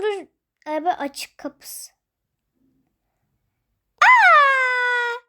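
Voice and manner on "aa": crying wail. Pretend. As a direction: burst into a play cry. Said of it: "Bu neden böyle ağlıyor?". (yüksek sesli ağlama)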